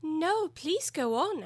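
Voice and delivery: high, slow, coaxing; apologetic plea to continue; very humble. A narrator's reading. Alice begs the Dormouse to continue, humbly. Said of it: "Alice said very humbly".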